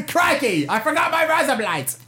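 high mock-Aussie dismay; hammed-up Australian exclamation; Australian accent. Friend topping the joke, high-pitched Australian accent. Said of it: "ways to speak Australian".

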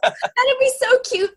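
high, slow and laughing. Real, not acted. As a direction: laugh it out with delight. Amused at the houseboat image.

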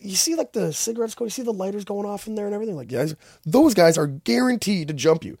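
high, mock-furious cop rant; an imitated cop berating naive tourists; agitated cop. Cop warns about gang.

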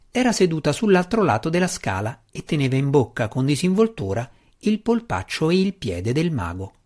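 usual speaking voice

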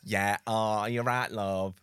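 put-on high, slow Yorkshire woman accent; broad Yorkshire lady, chatty. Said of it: "[name] also does a Yorkshire woman".

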